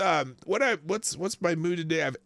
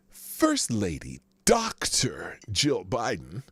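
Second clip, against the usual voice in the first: DOCTOR set apart slowly, each word spaced; mocking stress on Doctor; 6 semitones lower, much slower than usual. Shown as a demonstration. Host reading a headline to his audience, mocking stress on Doctor. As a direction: read it slowly, leaning pointedly on Doctor.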